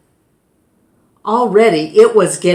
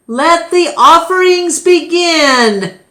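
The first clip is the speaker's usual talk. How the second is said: proclaiming; 10 semitones higher, 7 dB louder than usual. Acted. Keeper opens the ceremony aloud.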